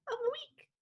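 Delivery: squeaky high chipmunk voice. Pretend. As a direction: pitch it way up like a chipmunk. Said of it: "you should say in a chipmunk voice".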